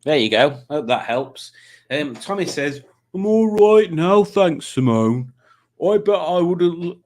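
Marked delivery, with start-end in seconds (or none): 3.1-7.0 put-on slow, loud, higher comic accent; drawn-out, hammed-up accent; Tommy's put-on accent. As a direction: read it in a laboured imitation accent. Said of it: "reading things in Tommy's accent".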